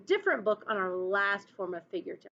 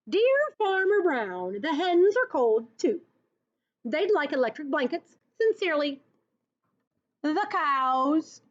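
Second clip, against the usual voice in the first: higher, louder letter-reading voice; cows' letter; 5 semitones higher, 3 dB louder than usual. Acted. Teacher reading the cows' note to Farmer Brown, higher voice. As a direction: read the cows' note with high sweetness.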